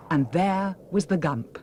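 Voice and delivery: usual speaking voice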